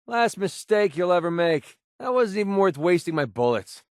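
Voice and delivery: normal talking voice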